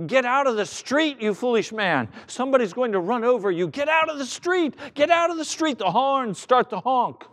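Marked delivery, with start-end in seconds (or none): 0.1-5.8 high, urgent acted street yelling; irate driver calling out, pitch climbing; drivers shouting. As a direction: yell at a fool in the road. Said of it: "Drivers start to shout out the windows".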